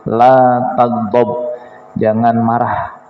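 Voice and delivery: low, slow and measured; slow solemn advice. Retold or imitated. Preacher voicing the Prophet's answer, slow and grave. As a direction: let each word of the warning land slowly. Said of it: "Apa jawaban Rasul?".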